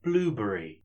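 normal talking voice

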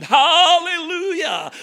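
loud, high hammed-up cheer; mock joyous shout; mock hallelujah. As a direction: parody the churchgoer whose life is easy. Mocking cheery churchgoer.

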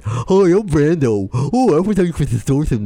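mock the troll's cringe impression of you; silly mocking voice. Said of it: "a silly voice".

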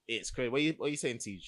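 ordinary talk

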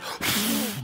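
short puff of breath; blowing on flames. Shown as a demonstration. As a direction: blow out air as if fanning embers. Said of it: "blowing on the flames".

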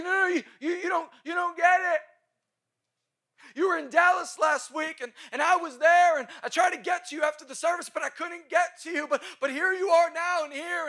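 high, loud, breathless excitement; imitating an excited young woman; excited young girl. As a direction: gush it out like an eager fan. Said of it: "She's like".

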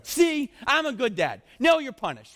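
high, loud mock-parental outburst; scolding dad. Dad showing complaining kids others' struggles, mock scolding. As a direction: scold the kids with hammed-up indignation.

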